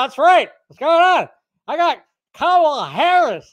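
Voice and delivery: put-on high, loud, mumbling old president voice; Biden impression. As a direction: play a confused, rambling old politician. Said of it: "first time I tried to do a Biden impression".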